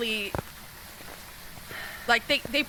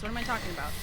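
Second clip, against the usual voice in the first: usual speaking voice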